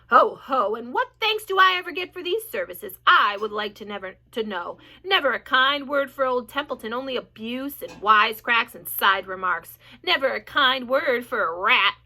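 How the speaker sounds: loud, self-pitying rat grumble; sulky sarcastic rat. A narrator's reading. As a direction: whine with heavy sarcasm.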